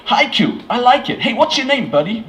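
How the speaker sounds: delighted American, pitched high; bad American accent. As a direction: approve heartily in a hammy American accent. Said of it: "a terrible American accent".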